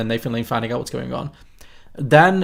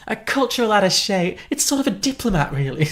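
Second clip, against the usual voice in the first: high, breathy-free mock society lady, rising much higher; Diane Wiest impression; 6 semitones higher than usual. Imitating the film's attaché line, high and put-on. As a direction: ham up the prim diplomat explanation, pitched way up.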